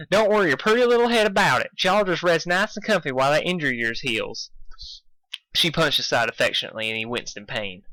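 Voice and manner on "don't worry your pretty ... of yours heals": high, quick country reassurance; proud farm pony voice; Applejack voice. Acted. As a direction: reassure her brother, pitched up.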